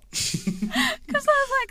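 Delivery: high, slow words through laughter; words cracking with laughter; laughing. Real, not acted. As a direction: talk through helpless laughter. Laughing hard while talking.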